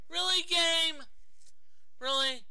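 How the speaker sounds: high, loud outburst of disbelief; exasperated shout. Real, not acted. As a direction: call the game out at the top of your voice. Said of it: "a bit exasperated".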